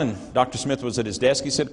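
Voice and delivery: ordinary talk, nothing marked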